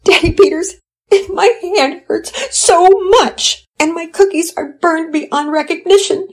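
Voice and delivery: tearful wail. Acted. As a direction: wail through the hurt like a sobbing mother.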